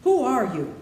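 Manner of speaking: high, loud frightened gasp; startled cry. Acted. Dorothy cries out to the voice in fright. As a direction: blurt it out in alarm.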